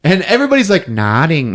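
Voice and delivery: usual speaking voice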